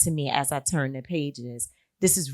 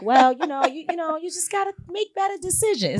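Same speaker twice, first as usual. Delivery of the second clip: high, mild fatherly advice; light impression of dad advising; gentle dad; 10 semitones higher than usual. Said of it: "my father's like".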